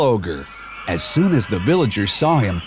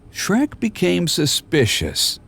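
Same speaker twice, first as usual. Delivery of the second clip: plain reading voice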